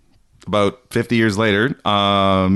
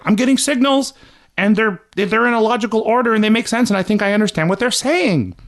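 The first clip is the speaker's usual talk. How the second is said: excited Tesla; 12 semitones higher than usual. Retold or imitated. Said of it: "So he was like".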